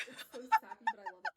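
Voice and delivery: real laugh breaking into the words. Real, not acted. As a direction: talk through a burst of laughter. Laughing at the sappy proposal.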